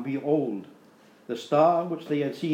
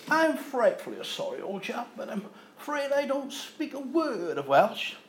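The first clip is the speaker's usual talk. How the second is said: put-on much higher, plummy posh English accent; 9 semitones higher than usual. Stranger apologises to the Welsh farmer in a posh English accent. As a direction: play the frightfully polite posh Englishman. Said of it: "in the most frightfully posh of English accents".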